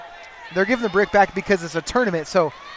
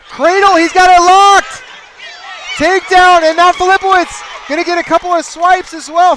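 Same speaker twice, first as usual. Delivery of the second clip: shouting, much higher, the takedown call; excited play-by-play; 7 semitones higher, 8 dB louder than usual. Acted. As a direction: build to a yelling peak on the takedown.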